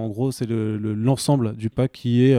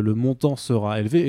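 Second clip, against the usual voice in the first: usual speaking voice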